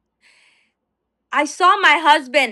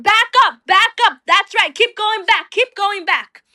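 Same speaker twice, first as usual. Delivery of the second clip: higher, louder commands; crowd shouting; 5 semitones higher, 4 dB louder than usual. Retold or imitated. Protesters shouting the van back, higher loud commands. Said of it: "And they're saying".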